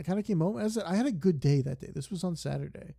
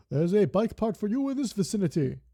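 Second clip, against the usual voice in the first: higher and louder, bright NPC delivery; voicing a game character; 6 semitones higher, 6 dB louder than usual. Game character telling the player about a bike part, higher and louder. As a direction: announce the bike part like a helpful robot.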